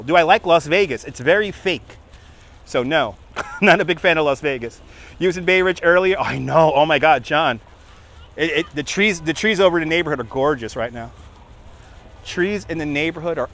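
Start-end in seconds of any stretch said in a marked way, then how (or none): none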